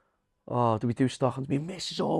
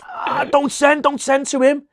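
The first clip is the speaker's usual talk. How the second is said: mock pleading; 12 semitones higher, 6 dB louder, slower than usual. Retold pleading to friend.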